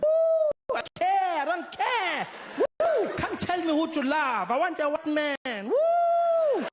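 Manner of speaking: high, loud, hammed-up carefree mom; mock rebellious mother whooping; defiant mom. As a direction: belt it out like she doesn't care at all. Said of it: "she's like".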